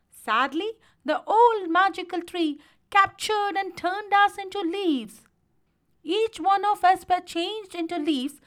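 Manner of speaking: high, projected, declaiming dwarf voice; high, insistent storybook dwarf; eldest dwarf. A narrator's reading.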